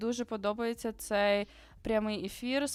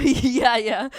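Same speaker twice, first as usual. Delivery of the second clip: a real laugh spilling into the word; laughing yeah; 3 semitones higher, 9 dB louder than usual. Real, not acted. Streamer answering chat's Ukrainian question, laughing. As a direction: laugh it out, caught off guard.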